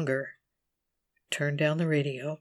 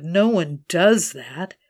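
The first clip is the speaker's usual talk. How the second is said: loud, slow, scolding; accusing; 7 dB louder, slower than usual. Acted. The inner voice scolding her for sharing her diary, loud. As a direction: hammer the shame on herself.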